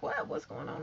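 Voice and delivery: soft, breathy near-whisper, playing dumb; imitating a quiet confused whisper; hushed innocent question. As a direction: hush it like you have no idea. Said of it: "Tasha K was like".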